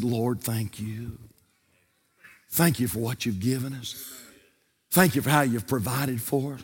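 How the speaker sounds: soft mock grace. Family saying grace quoted.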